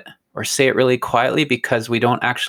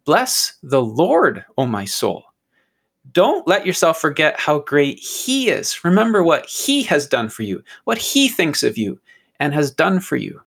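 David exhorting himself; 2 semitones higher than usual. Acted. David urging his own soul to remember God, exhorting. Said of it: "He's saying".

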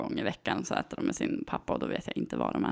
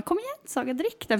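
coaxing; 10 semitones higher than usual. Retold or imitated. Mom coaxing child to drink.

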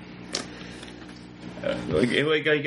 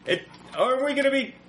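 shouting over machinery; 8 semitones higher than usual. Acted. Speaking over loud generator.

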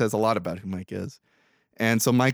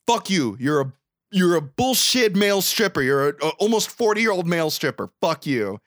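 acted-out indignant tirade, pitched up; higher, forceful imitation of a furious rant; angry outburst; 8 semitones higher, 4 dB louder than usual. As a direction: lash out as if telling someone off. Acts out Brooke's furious insults.

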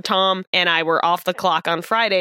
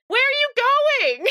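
much higher, slower mock call; mock surprised call; slower than usual. A friend calling after him as he leaves, high slow put-on voice. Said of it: "one of them went".

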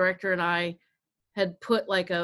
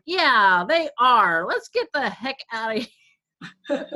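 mock agreement; 4 semitones higher, 6 dB louder than usual. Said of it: "and I'm like".

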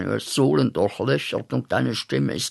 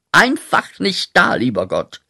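bitterer Ausruf; 6 semitones higher, 6 dB louder than usual. Acted. Anklage gegen Gott.

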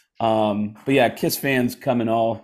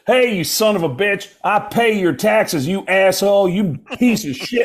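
higher-pitched retold tirade; mock angry yelling; 5 semitones higher than usual. Texas buddy starts yelling at the cop, mock outrage. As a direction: rail at him, half-yelled. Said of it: "he starts yelling at him".

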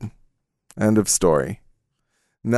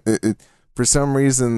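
normal talking voice

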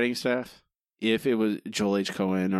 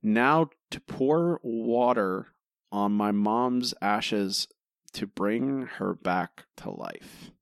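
mocking slow; much slower than usual. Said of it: "Homer says literally".

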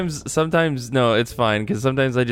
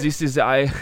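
put-on German accent breaking into laughter; mock German accent. Host attempts the German intro, laughing mock German accent. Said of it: "gonna do it in German".

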